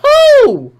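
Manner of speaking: high, loud "who?"; incredulous yelp. Real, not acted. Mocking shocked fans, high loud disbelieving who. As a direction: scoff in disbelief.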